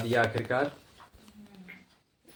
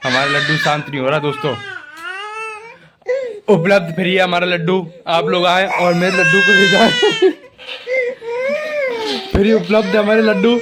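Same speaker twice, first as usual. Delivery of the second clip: louder, higher mock giveaway announcement; mock sales pitch; 7 semitones higher, 6 dB louder than usual. Vlogger jokingly offering his crying puppy free to viewers. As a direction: joke like hawking the puppy for free.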